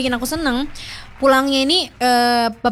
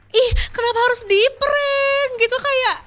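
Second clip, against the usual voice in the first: much higher, outraged little squeal; indignant squeal; 10 semitones higher than usual. Real, not acted. Complaining to listeners about being pranked, squealing higher.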